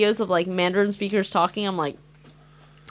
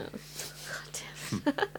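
whisper; 6 semitones higher, 15 dB quieter than usual. Real, not acted. Whispered after laughing.

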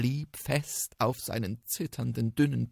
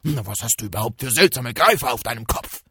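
loud, higher challenging tiger voice; 4 semitones higher, 13 dB louder than usual. Acted. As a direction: bellow at the strange beast, faster at the end. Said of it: "rief der Tiger". (lauter, herausfordernder Tiger)

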